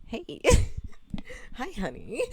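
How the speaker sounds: a lightly voiced flirty greeting, broken by a laugh; a bit flirty. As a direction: toss out a playful, giggly hello. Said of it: "a little more flirty".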